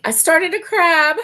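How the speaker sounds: loud, high laughing confession. Real, not acted. Admitting to viewers she began a crab, laughing. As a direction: blurt it out through laughter.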